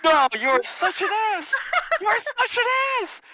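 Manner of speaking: high, loud laughing protest; outraged protest. Real, not acted. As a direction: yell it in mock outrage. Friend reveals his address.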